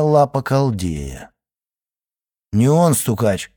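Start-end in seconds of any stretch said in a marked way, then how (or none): none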